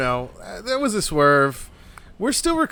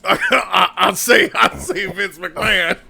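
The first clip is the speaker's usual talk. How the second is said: slow laughing Southern cartoon drawl; I say, I say, like a cartoon rooster; Foghorn Leghorn; slower than usual. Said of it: "You don't sound like Vince".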